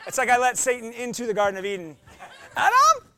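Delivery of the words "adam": high laughing shriek of the name; wife calling out, laughing. Retold or imitated. Wife calling her husband about the roach, laughing. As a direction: squeal the name through a laugh. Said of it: "When my wife says this".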